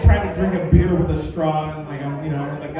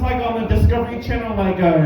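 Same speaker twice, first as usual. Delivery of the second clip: ordinary talk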